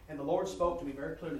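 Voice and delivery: normal talking voice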